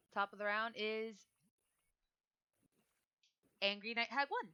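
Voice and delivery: ordinary talk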